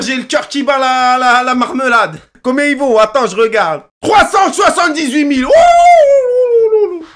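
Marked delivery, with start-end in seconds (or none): 4.0-7.0 loud high laugh then a yelped oh; laughing shout of surprise. Real, not acted. Gamer reads the card value to viewers, stunned. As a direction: shriek at the price.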